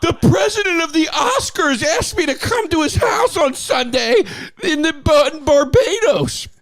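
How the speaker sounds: mock crying celebrity moan; whiny impression. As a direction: play a spoiled star complaining about his busy life. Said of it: "Here's my impression of you".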